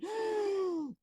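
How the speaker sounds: sudden high gasp of fright; sharp gasp. Retold or imitated. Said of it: "him going".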